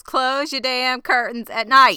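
emphatic scolding, each word stretched and punched; all-capitals emphasis. Shown as a demonstration. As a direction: read it out as if written in capitals. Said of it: "I have in all capitals".

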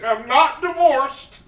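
hammed-up shouty character quote, slowing on the age; SNL sketch character. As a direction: boom it out like a washed-up life coach. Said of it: "Then I would change it up".

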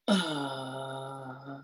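very low, soft uh like mud; deep low tone. Shown as a demonstration. Guest sounds the anchoring tone, a deep soft drone. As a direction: drop into a low, quiet uh. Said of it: "it's like the word mud".

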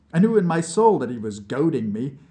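usual speaking voice

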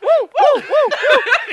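high shrill woo, woo, woo, woo; high laughing Ric Flair woo. Retold or imitated. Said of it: "He's gonna be like".

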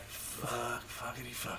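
soft, under-the-breath string of curses; hushed mock panic; retold dismayed cursing. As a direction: imitate quietly cursing to yourself. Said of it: "And I'm like".

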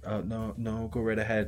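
hesitant, frightened permission, lightly imitated; timid and scared. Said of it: "just timid and scared out of his mind".

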